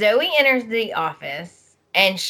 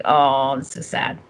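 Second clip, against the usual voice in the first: mock sad sigh; 6 semitones lower than usual. Reaction to crying scene.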